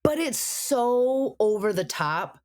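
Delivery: slow, leaning hard on every word; drawn-out emphasis. Real, not acted. Podcast host complaining about the actor's accent, slow emphatic drawl. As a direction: stretch it out to show how much it grates.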